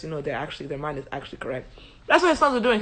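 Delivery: usual speaking voice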